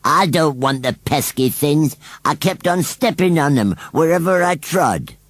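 high-pitched sulking scarecrow; sulky scarecrow. A narrator's reading. Scarecrow excusing himself to the children, sulky and high. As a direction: grumble it in a put-on scarecrow voice. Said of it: "Gummidge looked terribly sulky".

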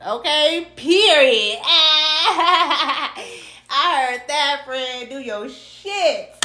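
drawn-out loud cheer with a laugh; hype cheer. Real, not acted. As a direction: gas them up like a best friend, laughing. Hyping up the reading, cheering.

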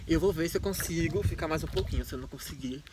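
trembling voice from the cold; shivering. Real, not acted. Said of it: "eu tô tremendo muito".

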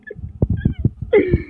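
very high squeal of laughter. Real, not acted. Laughing at dog.

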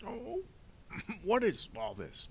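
high, slow, coughing banker; flustered Mister Poe, baffled. Acted. Banker looking over the evidence, flustered and baffled. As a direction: puzzle over it in a high fussy voice.

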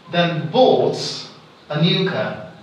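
"bought" emphasized, "a new car" tailing off; stress on bought. Shown as a demonstration. Teacher giving first example, emphatic stress on "bought". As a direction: land firmly on "bought", then ease off. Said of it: "I am emphasizing the word".

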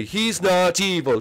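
normal talking voice

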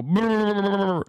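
playful lip-trill nuzzle noise; nuzzling brrr. Retold or imitated. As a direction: blow a raspberry into the dog's belly. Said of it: "I would go".

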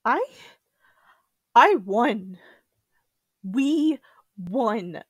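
loud, insistent protest, each word set apart; emphatic insistence. Acted. Survivor protesting to herself after nightmares, loud insistence. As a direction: push the words out forcefully, with a pause between.

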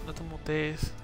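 normal talking voice

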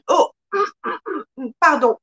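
normal talking voice

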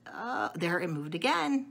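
ordinary delivery

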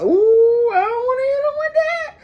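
high, loud, whiny mock cry; talking to the food in a whiny high cry; mock whimpering cry. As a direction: ham up a teary wail to the pot. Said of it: "I'm finna hit him with the".

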